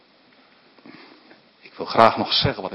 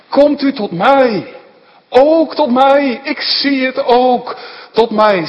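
much higher, louder, slow rapturous cry; ecstatic prayer; 11 dB louder, slower than usual. Acted. Believer crying to Jesus, slow rapturous high outcry. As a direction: cry out as if seeing Jesus near.

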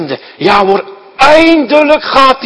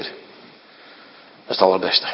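low, soft and slow; preacher lowers to a reverent hush about heaven; hushed awe; 10 semitones lower, 7 dB quieter, slower than usual. Acted. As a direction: savour it quietly, with reverence. Praising heaven.

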